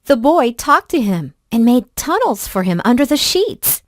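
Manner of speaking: ordinary delivery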